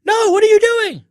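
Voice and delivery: very high, loud shriek; panicked yell. Acted. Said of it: "yelled the witch".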